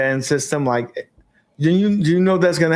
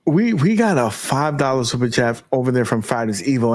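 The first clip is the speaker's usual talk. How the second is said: normal talking voice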